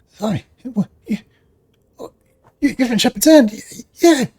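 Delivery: high, loud, startled stammer; half-orc hearing Shepherd's End, stunned; shocked disbelief. Acted. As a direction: squeak it out in shock.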